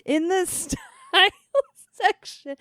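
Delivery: high, laughing, almost crying with glee; giddy disbelieving laugh-cry; laughing squeal. Real, not acted. Thrilled to be in newspaper.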